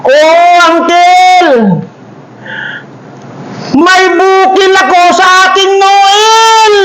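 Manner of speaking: high, slow mock wail; boy cries to the uncle; comic crying. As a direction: wail like a hurt child.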